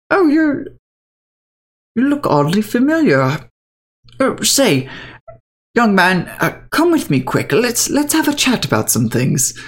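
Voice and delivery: put-on high, wavering, delighted old man; a fussy old man who suddenly recognises you; old gentleman.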